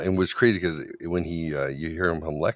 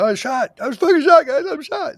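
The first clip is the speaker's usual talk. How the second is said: squeaky, hammed-up panic over being shot, much higher and louder; mock panic; 8 dB louder than usual. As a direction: overplay a shot soldier freaking out. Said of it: "you can be like".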